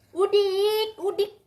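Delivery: a mum loudly teases the cat who refuses medicine; loud teasing. Real, not acted. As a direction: jeer at the cat like a bumpkin.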